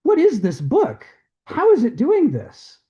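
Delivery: Stephen's stunned reaction to the talking book, high and loud; astonished exclamation. Retold or imitated. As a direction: gasp it out like you can't believe the book. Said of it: "And Stephen goes".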